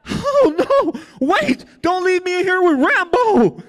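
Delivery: scared inmate begging the giant not to leave; panicked plea. Retold or imitated. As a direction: beg in a shrill panic. Panicked plea, high and loud.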